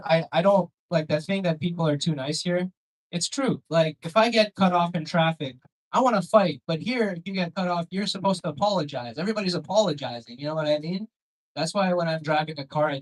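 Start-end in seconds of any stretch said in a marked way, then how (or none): none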